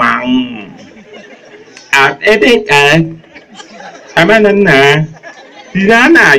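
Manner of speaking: halting wordless grunts; strained grunting ah and na sounds; mute man's grunts. Acted. Mute man's noises acted.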